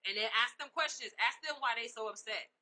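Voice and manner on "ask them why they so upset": ordinary talk, nothing marked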